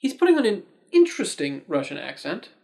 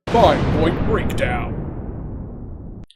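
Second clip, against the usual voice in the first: slow, louder dramatic segment title; announcer voice; 6 dB louder, much slower than usual. Shown as a demonstration. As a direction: announce it like a trailer. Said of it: "that brings me to my five-point breakdown".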